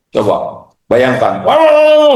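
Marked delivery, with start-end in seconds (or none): 1.4-2.1 loud high wow; exaggerated wow. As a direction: burst out in mock amazement. Exaggerated exclamation. (seruan wow yang dilebih-lebihkan)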